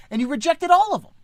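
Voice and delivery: high, loud, fast rebuke; exasperated scolding God. Retold or imitated. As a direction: throw the list back at him. God scolds the old man.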